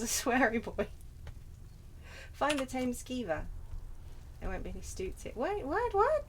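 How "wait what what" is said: high, rising disbelief; baffled surprise. Real, not acted. Player thrown by quest location, baffled surprise. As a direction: question it, climbing higher.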